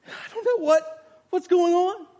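high, loud, acted astonishment; overwhelmed bewilderment. Lame man suddenly feeling strength in his legs, overwhelmed bewilderment.

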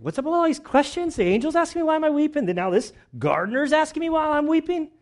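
huffy, can't believe yet another question; exasperated Mary. Acted. Mary annoyed at the gardener, very high and fast exasperation. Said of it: "she is not happy".

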